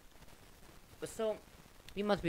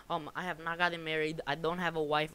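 usual speaking voice

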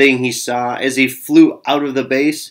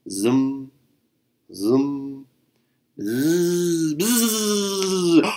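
buzzing bass; 4 semitones higher, 4 dB quieter, much slower than usual. A narrator's reading. Bass buzzes during the ball.

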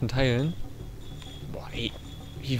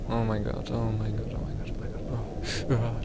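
hushed muttering; 5 dB quieter than usual. Real, not acted. Gamer to himself mid-level, hushed muttering. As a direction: say it softly under the breath. Tense moment in play.

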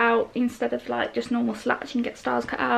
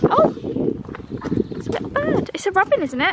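delighted surprise; 7 semitones higher than usual. Real, not acted. Spotting a robin in garden.

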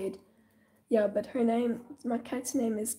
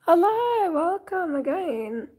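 excited greeting; 7 semitones higher, 7 dB louder, slower than usual. Real, not acted. Stream greeting, big hello.

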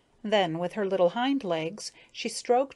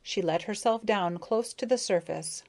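ordinary delivery, nothing marked

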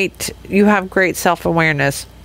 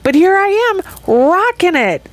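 triumphant; 9 semitones higher than usual. Retold or imitated. Triumphant comeback quote.

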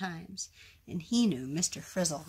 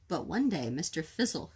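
usual speaking voice

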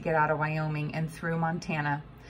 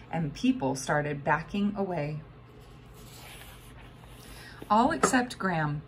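ordinary delivery

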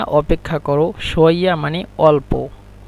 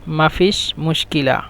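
normal talking voice

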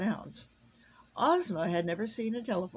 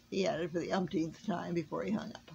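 usual speaking voice

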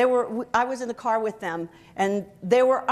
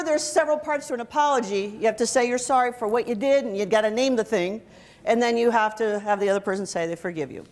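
ordinary delivery

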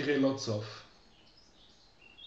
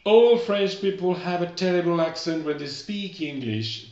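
dictation voice; 5 semitones higher, 7 dB louder, slower than usual. Shown as a demonstration. Teacher dictating a sentence.

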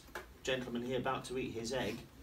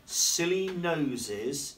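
slow, drawn-out rhyme reading; reading to children; 3 semitones higher, 5 dB louder, much slower than usual. A narrator's reading. Teacher reading rhymes to young pupils, slow and playful. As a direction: linger on each silly line. Reading rhyming picture book aloud.

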